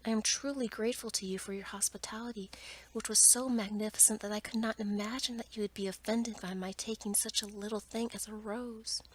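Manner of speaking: usual speaking voice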